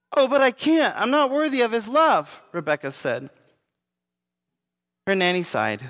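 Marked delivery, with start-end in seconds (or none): 0.1-2.4 put-on high, louder pleading girl voice; a little girl begging, near tears; pleading child. A narrator's reading. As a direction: cry out that you are unworthy. Distraught child pleads with nanny.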